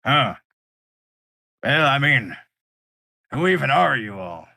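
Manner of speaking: a big lug fumbling for words; brute voice. Acted. Large pirate asking Thatch who they are, loud. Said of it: "He turns to Thatch and goes".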